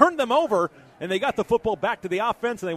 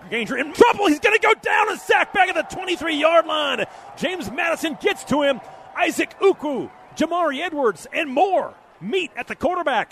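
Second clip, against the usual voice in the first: excited; 4 semitones higher than usual. Real, not acted. Sack call in live play-by-play.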